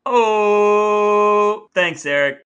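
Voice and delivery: loud, hammed-up burst of thanks; hammed-up glee at having a listener; mock delight.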